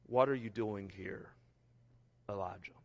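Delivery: soft, close, gentle voice of God; gentle whisper. Retold or imitated. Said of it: "came a gentle whisper".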